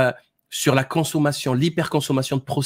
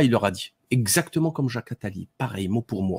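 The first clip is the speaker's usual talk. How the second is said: ordinary delivery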